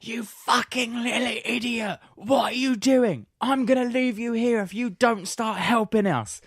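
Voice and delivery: high-pitched exasperated telling-off; losing temper. Retold or imitated. Housemate scolding drunk friend who won't help, losing temper. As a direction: re-enact an angry shout at a useless drunk. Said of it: "audibly shouting at Ollie. I was beginning to lose my temper".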